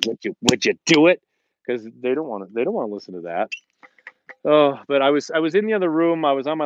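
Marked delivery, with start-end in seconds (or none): 0.1-1.2 mock pleading request. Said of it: "you can't just say".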